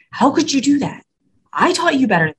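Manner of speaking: upset parent. Retold or imitated. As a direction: scold like a let-down parent. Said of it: "we kinda get upset".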